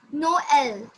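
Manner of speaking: Noel drawn out slowly as No, el; syllables apart. Shown as a demonstration. Teacher breaking down Noel for a student, syllables apart. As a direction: split Noel into two slow, separate syllables. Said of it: "you have to pronounce it together".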